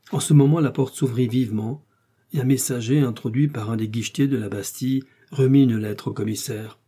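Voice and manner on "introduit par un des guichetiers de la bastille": ordinary delivery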